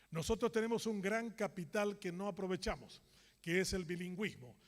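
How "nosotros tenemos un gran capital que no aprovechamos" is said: normal talking voice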